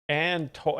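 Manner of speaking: ordinary talk, nothing marked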